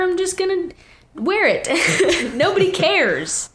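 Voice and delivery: high, laughing through the words; laughing defiance. Real, not acted. Teen laughing off dress rules to parent, high and laughing.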